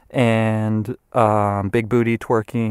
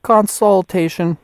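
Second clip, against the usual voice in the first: slow, high word for a robot; 9 semitones higher, 3 dB louder than usual. Real, not acted. Saying the word to the phone robot.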